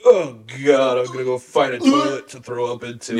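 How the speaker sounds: loud, disgusted mock groan. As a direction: act revolted and queasy. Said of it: "and she just goes".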